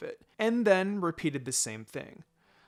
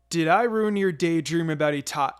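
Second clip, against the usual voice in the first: loud teasing; 3 semitones higher, 9 dB louder than usual. Acted. Teasing friend mid-quarrel.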